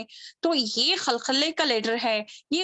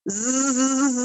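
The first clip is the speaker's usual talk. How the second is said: loud imitated bee buzz zz; honeybee buzz; 4 dB louder than usual. Shown as a demonstration. Teacher imitating a honeybee's buzz for students. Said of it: "شہد کی مکھی کس طریقے سے آواز کرتی ہے؟".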